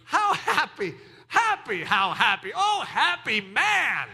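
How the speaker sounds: respond with hearty, drawn-out delight; demonstrated burst of joy; happy exclamation. Shown as a demonstration. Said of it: "you can respond that way".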